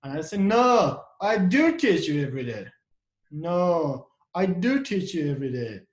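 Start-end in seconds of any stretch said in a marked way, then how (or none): 0.4-2.7 loud protest, DO punched; stress on DO. Acted. Teacher defending himself against students' complaint, loud stress on DO. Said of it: "I put a stress on the do". 3.3-5.8 firm denial, DO emphasized; stress on DO. Acted. Teacher answering students' complaint, stress on DO. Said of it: "I put a stress on the do".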